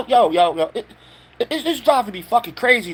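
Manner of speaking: ordinary delivery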